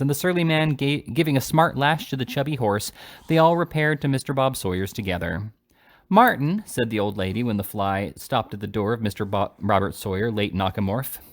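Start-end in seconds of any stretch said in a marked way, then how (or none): 6.1-6.6 calling out. A narrator's reading. Old lady calls the driver.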